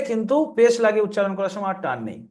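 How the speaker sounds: ordinary delivery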